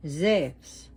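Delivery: Zeus said "zefs", with a v-like u; Zeus the Greek way, as "zevs"; Greek pronunciation. Shown as a demonstration. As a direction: make Zeus one syllable, "zevs". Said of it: "but in Greek is Zeus".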